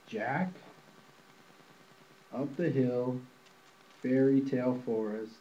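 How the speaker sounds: usual speaking voice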